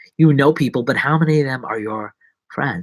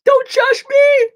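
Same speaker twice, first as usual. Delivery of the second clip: squeaky high slow yell; high hammed-up cry; mock shout; 8 dB louder, slower than usual. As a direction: shriek it in mock defence. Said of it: "You're like".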